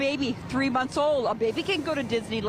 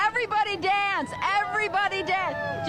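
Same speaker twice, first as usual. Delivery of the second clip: rally the crowd, upbeat and higher; excited call; 6 semitones higher than usual. Real, not acted. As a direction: lively call to everyone to dance. Cheering crowd to dance.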